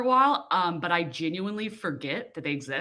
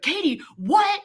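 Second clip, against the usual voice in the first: surprised; 12 semitones higher, 3 dB louder, slower than usual. Retold or imitated. Customer shocked at hearing Katie.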